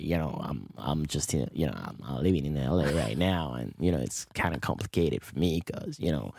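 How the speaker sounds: put-on low, soft, laid-back American accent; best American accent. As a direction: fake being American, pitched well down. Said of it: "je parle que de là".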